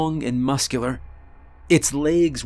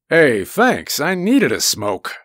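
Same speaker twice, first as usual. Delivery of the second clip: louder, lightly voiced casual monster; pretend beast's reply; 4 dB louder than usual. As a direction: play the monster as a relaxed smoker. Imagined reply of the Sasquatch.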